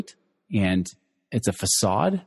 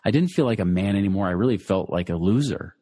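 usual speaking voice

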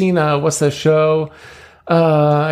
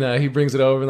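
ordinary talk, nothing marked

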